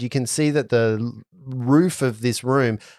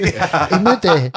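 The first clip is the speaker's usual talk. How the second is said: put-on hammy French-accented name; 3 semitones higher, 3 dB louder than usual. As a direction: overplay the French sound of "Imade". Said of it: "No, don't even try".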